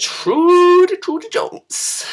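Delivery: loud, then drawn out and breathy; dramatic announcement. Acted. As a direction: announce the name like a big reveal.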